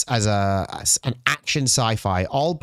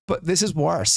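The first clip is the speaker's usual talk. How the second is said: normal talking voice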